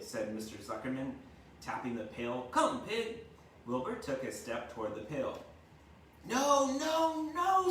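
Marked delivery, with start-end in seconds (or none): 2.5-3.2 much higher call, a bit louder; coaxing call across the yard; farmer calling. A narrator's reading. Farmer calls the pig. 6.3-7.7 very high, louder honking goose; frantic goose cry. A narrator's reading. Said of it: "said the goose".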